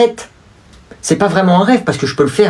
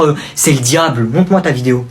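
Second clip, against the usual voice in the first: ordinary talk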